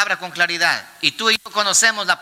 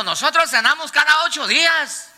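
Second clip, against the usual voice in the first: mock boast; 8 semitones higher than usual. Mocks boastful church members.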